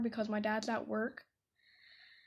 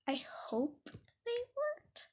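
whisper; 11 semitones higher, 7 dB quieter, slower than usual. Real, not acted. Whispered hope after tips.

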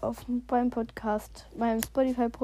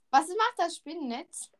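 ordinary talk, nothing marked

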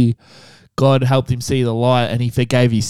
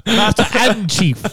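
higher, sermon-like mock dad; mock preachy dad; 6 semitones higher than usual. Mocking the dad correcting the son, higher. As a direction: correct him like a pious father.

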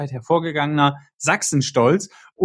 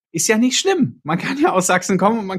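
emphatic high; 5 semitones higher than usual. Real, not acted. Defending local pride.

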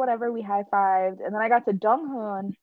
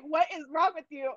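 laughing self-scolding; 8 semitones higher, 4 dB quieter than usual. Retold or imitated. Scolds herself in retelling, laughing.

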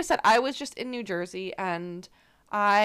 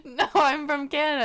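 laughing; 6 semitones higher than usual. Retold or imitated. Own voice in retold exchange.

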